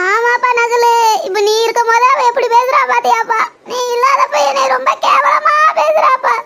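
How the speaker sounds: milk the son's sympathy with a whimper; fake pitiful whine. Mother whines to her son for affection, pitiful and slow.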